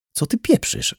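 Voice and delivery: high, irritated disbelief; annoyed. A narrator's reading. Twin refuses to understand him, getting annoyed. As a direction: throw the question back, mildly annoyed. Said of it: "zdenerwowała się".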